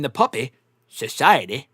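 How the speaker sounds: lightly imitated movie line, halting between words; movie character impression. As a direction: mimic his odd way of saying it. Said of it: "like, the way he says it".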